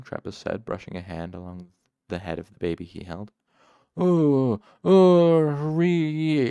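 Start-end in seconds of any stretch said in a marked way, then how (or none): 4.0-6.5 loud, drawn-out high bellow with gasps. A narrator's reading. Tenny crying out loud from his cot, bellowing. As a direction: bellow it out, long and pained. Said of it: "Tenny bellowed".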